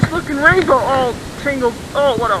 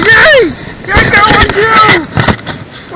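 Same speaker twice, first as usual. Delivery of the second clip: high, loud cheer with stretched words; 5 semitones higher, 4 dB louder, much slower than usual. Real, not acted. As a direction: draw it out in real triumph. Celebrates second catch.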